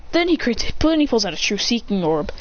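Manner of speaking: plain reading voice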